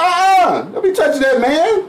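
high-pitched mock parent telling off; mock parental scolding. Voicing how mama should scold.

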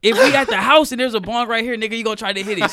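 mom scolding. Retold or imitated. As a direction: scold him like a fed-up mom. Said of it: "She's like".

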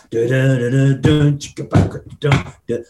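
scat the drum groove, da da chicka ba; vocal drum beat. Retold or imitated. Drummer mouthing the song's beat to his bandmate, vocal drum beat. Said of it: "He says, "Right, it starts".

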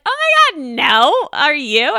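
high, loud imitation of a stranger; mock gushing denial. Strangers in line answer her question, gushing high and loud. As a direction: exclaim it brightly, dropping from a very high start.

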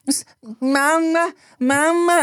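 high, slow baby imitation. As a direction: stretch out mama like a baby. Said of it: "And it was like".

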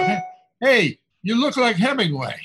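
loud, high doorman's call; imitated shout. Doorman hailing a stranger on a Manhattan street, shouting. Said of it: "shouted out".

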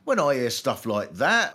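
usual speaking voice

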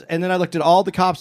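usual speaking voice

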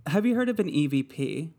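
ordinary talk

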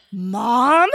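loud, rising astonished question; stunned disbelief. Retold or imitated. Lauren shines the light in her face, stunned. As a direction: draw it up into a shocked question. Stranger turns out to be Mom.

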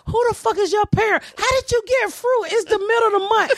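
high, excited disbelief; amazed child. Retold or imitated. Comic voicing her childhood self at the cage, high amazement. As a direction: squeal it like a poor kid at the zoo.

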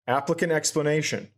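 usual speaking voice

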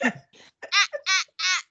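mock dismissive ah ah ah, laughing through it. Recapper mimics Michaela shushing Zach, laughing. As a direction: cut him off with a laughing ah, ah, ah. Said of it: "This is verbatim, guys".

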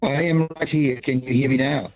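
usual speaking voice

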